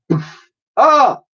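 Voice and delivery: high loud cry of shock; pained yelp. Acted. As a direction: squawk as you're whacked. Professor hit, cries out.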